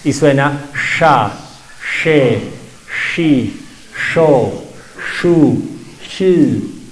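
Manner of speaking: sha, she, shi, sho, shu, shi with the tongue pulled back; Chibcha sh syllables. Shown as a demonstration. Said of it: "la lengua se recoge, se va para atrás".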